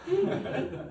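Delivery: high quick giggle; laugh. Real, not acted. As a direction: burst into a giggle. Reader laughs mid-passage.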